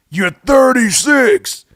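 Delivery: very high, loud, slow mock Budden; [name] impression. Budden telling [name] his age, loud slow impression. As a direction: belt it out as Budden. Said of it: "to my best [name]".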